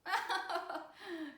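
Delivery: hearty laugh; grandfather's laugh. A narrator's reading. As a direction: laugh at himself. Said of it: "lacht der Mäusegroßvater". (herzhaftes Lachen des Großvaters)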